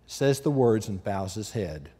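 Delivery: normal talking voice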